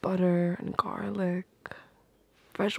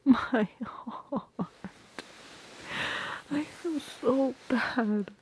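touched sigh; 6 semitones higher, much slower than usual. Acted. Touched, remorseful.

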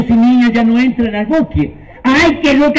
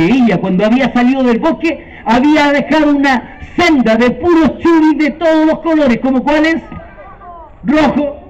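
usual speaking voice

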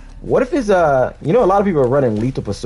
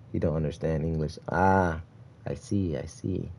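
normal talking voice

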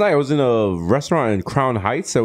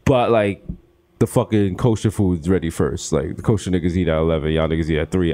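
ordinary talk

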